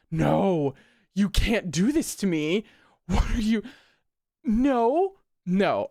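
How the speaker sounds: loud, high no no no; panicked yelling. Real, not acted. Reader yelling in panic at the page. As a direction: shout in real alarm. Panicked reaction to comic twist.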